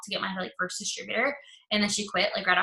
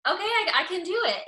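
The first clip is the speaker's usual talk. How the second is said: imitated eager yes, higher and louder; newfound confidence; 9 semitones higher, 6 dB louder than usual. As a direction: act out her keen, high-pitched resolve. Retelling recruit finding her confidence.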